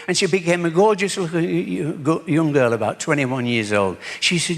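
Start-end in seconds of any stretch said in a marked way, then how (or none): none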